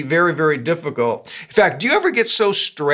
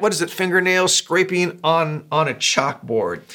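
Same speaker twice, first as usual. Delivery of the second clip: ordinary delivery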